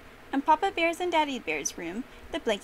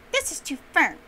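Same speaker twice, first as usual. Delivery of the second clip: higher, louder complaint; complaining; 5 semitones higher, 7 dB louder than usual. A narrator's reading. Goldilocks trying out the big bed, complaining. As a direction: complain in a raised voice.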